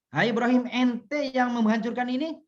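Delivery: a touch of a stern interrogator; accusing king's question. Acted. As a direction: call out Ibrahim and demand an answer. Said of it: "Ketika ditanya".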